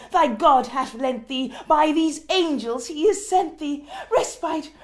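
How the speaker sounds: high and loud, urgent declaiming; crying out. Acted. The mourner cries out to himself of relief from Lenore, loudly. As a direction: hurl the promise of relief upward. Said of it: "I cried".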